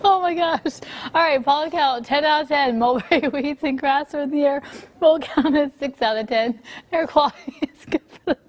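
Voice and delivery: reading through giggles; holding back laughter on air; trying not to laugh. Real, not acted. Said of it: "We have the giggles this morning".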